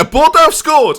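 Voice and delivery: high, loud, delighted burst; excited outburst. Real, not acted. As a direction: cheer the news out. Rival's opponent scores, excited.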